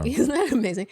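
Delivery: normal talking voice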